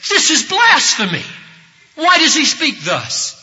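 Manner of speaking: high, loud indignant accusation; outraged leaders. Retold or imitated. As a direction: cry out in scandalized protest.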